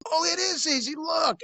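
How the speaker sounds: very high, singsong playing along; put-on innocent enthusiasm; mock eagerness. Undercover officer playing along before revealing badge.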